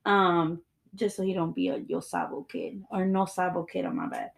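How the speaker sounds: ordinary talk, nothing marked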